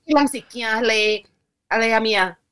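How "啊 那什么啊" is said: ordinary talk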